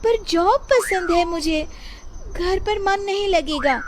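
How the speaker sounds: put-on very high, plaintive young wife; protesting wife. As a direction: plead to keep your job. (ऊँची आवाज़ में मायूस पत्नी)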